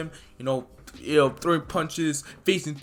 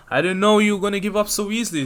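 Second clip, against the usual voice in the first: taunting Mirio; 7 semitones higher, 6 dB louder, much faster than usual. Retold or imitated. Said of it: "And Miro's like".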